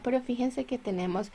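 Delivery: normal talking voice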